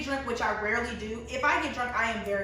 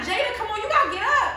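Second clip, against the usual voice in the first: friend urging; 12 semitones higher, 7 dB louder, faster than usual. Retold or imitated. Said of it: "He's like".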